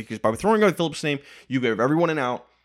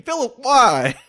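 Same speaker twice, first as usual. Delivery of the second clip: loud, high mock despair; hammed-up exasperated shout; exasperated coach; 13 semitones higher, 6 dB louder, much slower than usual. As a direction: cry out like a baffled coach, pitch way up. Repeats plea as Philip's coach.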